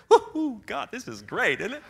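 high whoop then laughing; mock jubilant cheer. Believer cheering God under attack, playful laughing whoop. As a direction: cheer it like good news, laughing. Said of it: "You should say".

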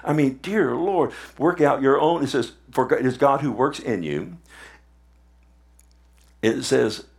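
ordinary delivery

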